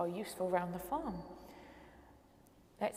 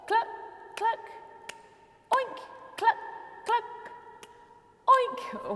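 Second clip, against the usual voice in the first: oink squealed much higher and louder; animal noises; 12 semitones higher, 5 dB louder than usual. Shown as a demonstration. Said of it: "one more with a chicken and a pig".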